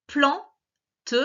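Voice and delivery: "Plante" divided plan / te; split into syllables. Shown as a demonstration. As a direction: sound out "Plante" with the final e voiced separately. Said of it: "la première syllabe de chaque mot".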